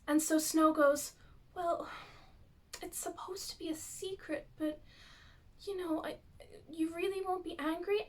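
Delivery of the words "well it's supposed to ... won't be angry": put-on hushed whisper, a child letting slip a secret. Child Snow confiding Regina's secret to Cora, whispering. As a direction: whisper it like a kid sharing something forbidden.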